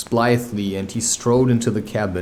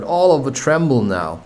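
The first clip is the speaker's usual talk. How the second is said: ordinary delivery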